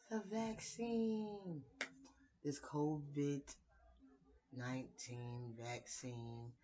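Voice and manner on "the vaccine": pitched higher and drawn out very slowly; dramatic reveal. Pretend. Vlogger unveiling the vaccine topic to viewers, dramatic slow reveal. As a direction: unveil the word like a big announcement.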